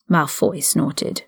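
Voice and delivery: ordinary delivery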